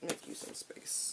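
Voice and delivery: soft whisper under the breath; whispered aside. Real, not acted. Reader whispering while clearing space for the cards. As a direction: whisper it to yourself.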